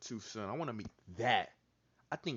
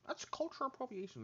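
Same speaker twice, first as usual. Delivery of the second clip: higher mock voice held over; mocking woke girl; 14 semitones higher than usual. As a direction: repeat the mock scold.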